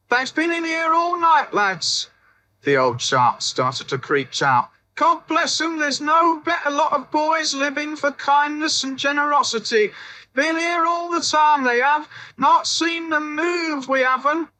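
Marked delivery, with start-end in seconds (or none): 0.1-2.1 put-on much higher quavery old woman, insisting; old babushka. A narrator's reading. Old woman vouches for boys. 4.9-14.5 put-on much higher quavery old woman, insisting; old babushka. A narrator's reading. Old woman vouches for boys.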